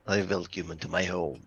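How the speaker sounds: low, hushed vampire welcome; courteous vampire. Acted. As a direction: greet them like a cliched vampire. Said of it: "I do just this weird sort of".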